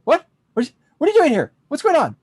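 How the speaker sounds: high, loud startled what; jolted out of his work, demanding answers; startled patcher. Acted. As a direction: patcher looks up confused at the stranger. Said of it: "looks up and says".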